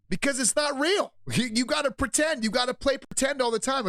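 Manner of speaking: high-pitched, worked-up insistence; exasperated rant. Real, not acted. Host answering why faking is so exhausting, worked up and exasperated.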